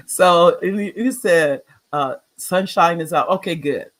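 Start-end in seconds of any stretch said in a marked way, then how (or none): none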